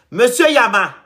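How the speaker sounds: loud, high summons; calling out. Acted. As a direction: call the next candidate out sharply. Calling out candidates by name.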